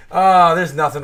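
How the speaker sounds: normal talking voice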